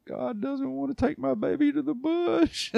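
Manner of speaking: high, tearful mock wife voice; lightly voiced weepy wife; imitating his crying wife. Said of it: "She goes".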